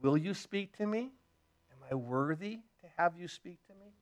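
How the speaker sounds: a soft doubtful plea; hushed question. Retold or imitated. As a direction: ask it almost under the breath, unsure. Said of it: "But then he says".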